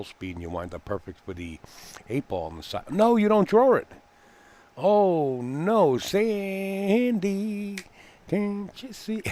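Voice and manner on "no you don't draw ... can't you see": high, loud cry of dismay at the shot; dismayed outcry. Real, not acted. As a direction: wince out loud as she misses. Commentator reacts to a missed shot.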